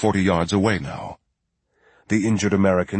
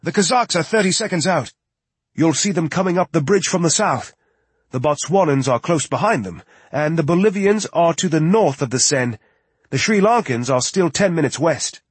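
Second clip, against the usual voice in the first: higher-pitched status report; urgent tech report; 8 semitones higher than usual. A narrator's reading. Tech answering Lloyd with team positions, urgent report.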